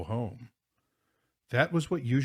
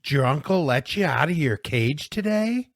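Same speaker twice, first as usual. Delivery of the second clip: loud, high schoolyard jeer; jeering taunt; 8 semitones higher, 8 dB louder than usual. Acted. Bully greeting his victim before the game, jeering. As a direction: draw out the mockery, loud and high.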